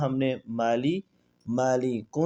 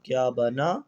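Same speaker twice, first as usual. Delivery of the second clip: Quranic recitation drill; much slower than usual. Shown as a demonstration. Teacher chanting the lesson's letters for students, drawn out. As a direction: intone each letter slowly like a recitation.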